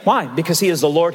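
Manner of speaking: normal talking voice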